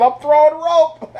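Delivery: high, slow, laughing quote; quoting the joke through high laughter. Retold or imitated. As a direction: squeal the line while cracking up. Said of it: "he said".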